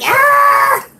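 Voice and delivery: high, drawn-out mock yelp; nervous yelp. Streamer acting out raid nerves, high nervous yelp. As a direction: cry out "ah" in acted panic. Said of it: "I always get nervous doing a raid".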